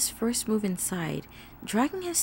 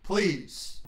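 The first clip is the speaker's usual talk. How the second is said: low voice, softening into please; terse, desperate deep-voiced request; urgent creature plea; 4 semitones lower, 4 dB quieter than usual. A narrator's reading. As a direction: demand deeply, then soften to a plea. Desperate creature begs for directions.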